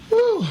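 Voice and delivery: sharp, high, loud exhale of relief; high whistling whew. Real, not acted. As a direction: blow out a long high whew. Exhale after a provocative question.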